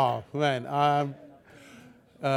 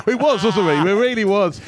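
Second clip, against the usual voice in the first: laughing banter; 8 semitones higher, 7 dB louder than usual. Real, not acted. Joking banter, laughing loudly.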